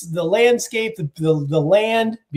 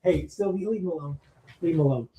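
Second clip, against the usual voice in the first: much softer, off to the side; real hushed aside; 10 dB quieter, faster than usual. Real, not acted. Guest interrupts story to scold Sylvie, hushed aside. Aside to someone in his room.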